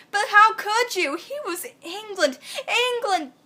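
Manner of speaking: hammed-up dismay, pitched high; dismayed protest. A narrator's reading. Story heroine reacts to England.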